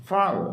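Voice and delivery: "father" starting high, falling quickly to the end; stress on first syllable. Shown as a demonstration. Teacher repeating the model word for students, "father" stressed at the front. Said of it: "father ആണ് stress ചെയ്യേണ്ടത്".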